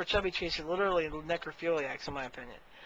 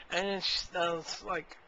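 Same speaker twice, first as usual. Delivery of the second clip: usual speaking voice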